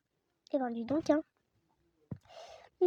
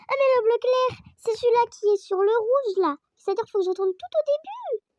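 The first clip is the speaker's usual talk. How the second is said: exasperated exclaim; 7 semitones higher, 8 dB louder than usual. Real, not acted. Exasperated discovery.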